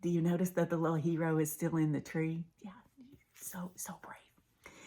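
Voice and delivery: quick whispered aside. Real, not acted. Storyteller whispering an aside to the listeners.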